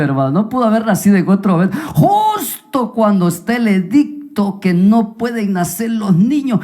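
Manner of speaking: usual speaking voice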